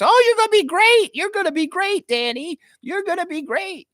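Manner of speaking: very high mock falsetto cheering; gushing encouragement. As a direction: imitate an over-the-top booster in a squeaky-high voice. Acts out colleague cheering Danny on.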